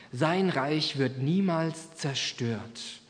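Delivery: each word of "Sein Reich wird niemals zerstört" drawn out slowly; slow solemn close. Shown as a demonstration. Preacher ending the Bible reading, slow and solemn. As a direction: let the promise land, word by word. Closing line of the prophecy.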